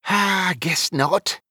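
slow, high sighed concession; resigned sigh. Acted. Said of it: "Wizfiddle sighed".

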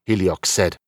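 plain reading voice

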